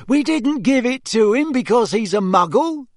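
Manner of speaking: high, loud protest; indignant. A narrator's reading. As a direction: protest the unfair accusation. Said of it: "said Fred indignantly".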